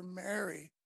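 hushed, gentle call by name; meaningful name-call. Acted. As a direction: say the name quietly, as if to someone dear. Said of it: "just the way he said the name".

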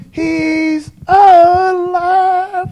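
very high, loud, drawn-out cry of praise; soaring, long-held hallelujah shout; Larry Berry shout. Retold or imitated. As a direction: stretch it out long and high like a preacher in full cry. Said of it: "I wish Larry Berry was here right now to say".

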